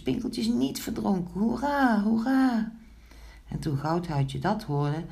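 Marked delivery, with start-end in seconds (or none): 0.0-2.7 high, joyful storybook cheers; cheering. A narrator's reading. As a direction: celebrate a rescue in a high voice. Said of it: "riepen de muisjes". (hoog en blij juichend)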